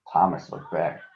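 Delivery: ordinary delivery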